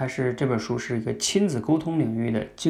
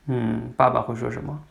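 usual speaking voice

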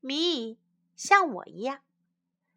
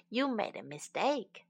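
usual speaking voice

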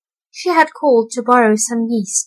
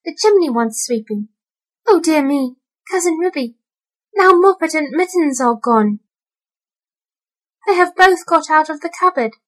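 higher, rising alarm; dismayed mother cat; 6 semitones higher than usual. A narrator's reading. Tabitha realising the kittens escaped, high and dismayed. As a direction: fret that the kittens are gone.

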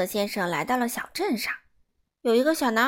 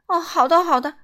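higher, louder flustered agreeing; hurried eager cry; 6 semitones higher, 6 dB louder than usual. Acted. Miser hastily agreeing to the washerwoman, flustered cry. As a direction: blurt it out in a panic. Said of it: "他慌忙叫道".

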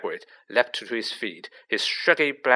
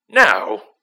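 loud, angry refusal; gruff giant's outburst; growled; 10 semitones higher, 11 dB louder than usual. A narrator's reading. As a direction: growl out a forceful protest. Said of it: "growled Hagrid".

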